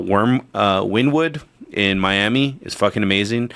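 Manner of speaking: ordinary delivery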